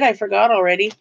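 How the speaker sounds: usual speaking voice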